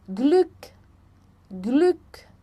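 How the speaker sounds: Glück with a short rounded ü; German pronunciation. Shown as a demonstration. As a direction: model Glück crisply. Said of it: "som entre o U e o I".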